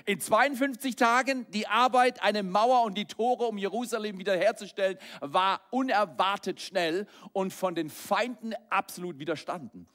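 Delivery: full-force sermon peak; fiery preaching. Acted. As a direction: drive it home like a revival preacher.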